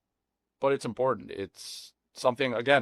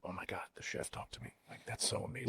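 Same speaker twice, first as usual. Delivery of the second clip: whispered starstruck guest; 4 semitones lower, 14 dB quieter than usual. Retold or imitated.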